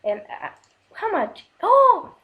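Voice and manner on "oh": very high, loud delighted oh; surprised oh. Real, not acted. Gamer discovering fifteen apples, surprised. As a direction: burst out in surprise.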